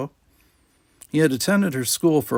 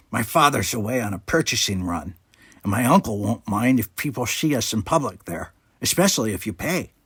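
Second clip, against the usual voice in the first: put-on nasal-free odd Ferengi kid voice; a scheming little alien; sly Ferengi boy. A narrator's reading. Nog suggests eating at his uncle's bar, a sly Ferengi kid voice.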